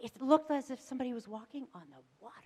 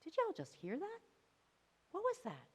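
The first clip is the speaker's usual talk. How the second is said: hushed, high-pitched questioning; bewildered disciples; 9 semitones higher, 6 dB quieter than usual. Acted. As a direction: ask each other in soft, startled disbelief. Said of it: "imagine them looking at each other".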